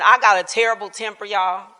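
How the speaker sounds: ordinary delivery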